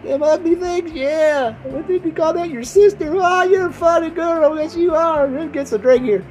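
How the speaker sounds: put-on high, hollering country drunk act; drinking self. Acting himself drinking, hollering to his listeners, rowdy high voice. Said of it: "Especially when I get drinking, it's like".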